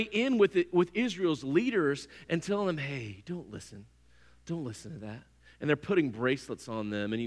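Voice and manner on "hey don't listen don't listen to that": soft, low murmur luring a leader away from the warning; seductive hush. Retold or imitated. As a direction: lean in close and coax softly. Women coaxing leaders, stroking hair.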